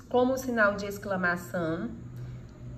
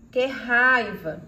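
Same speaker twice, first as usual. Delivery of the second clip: slow, louder, put-on anger; angry exclamation; 5 dB louder, much slower than usual. Shown as a demonstration. Teacher acting out anger for an exclamation, slow and loud. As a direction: spit out the anger slowly. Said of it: "No final de frase exclamativa".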